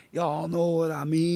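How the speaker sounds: put-on drawn-out Southern belle accent. Canadian imitating Southern belles for American friends, slow Southern accent. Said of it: "you guys got them Southern belles".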